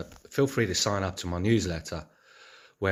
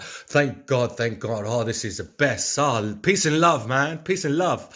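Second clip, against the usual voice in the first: imitating an elated man gushing thanks, building higher and louder; blissed-out gratitude; 5 semitones higher, 5 dB louder, slower than usual. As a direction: gush it like someone overjoyed and overwhelmed. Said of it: "he's like".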